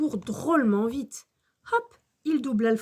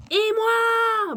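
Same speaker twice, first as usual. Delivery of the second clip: put-on high, loud child's bawl; Max qui braille; 10 semitones higher, 6 dB louder than usual. A narrator's reading. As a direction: yell to be included. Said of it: "braille Max". (braillement aigu de gamin)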